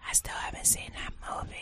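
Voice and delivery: quick hushed whisper. Real, not acted. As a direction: whisper it fast under your breath. Aside whisper after joke.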